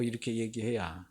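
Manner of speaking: 이렇게 얘기해야 dropped low and soft; a gentle quiet restatement; quiet echo. Shown as a demonstration. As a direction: repeat it softly, almost to yourself. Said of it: "성도가 이렇게 얘기해야".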